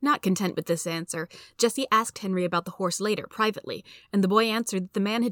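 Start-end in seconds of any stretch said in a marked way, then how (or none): none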